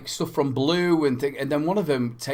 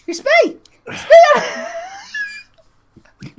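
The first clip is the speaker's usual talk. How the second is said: very high, loud squeal through laughter; mock wail, laughing; 6 dB louder than usual. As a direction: repeat the punchline helpless with laughter. Repeats the punchline, laughing.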